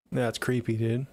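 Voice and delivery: normal talking voice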